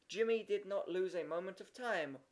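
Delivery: ordinary delivery, nothing marked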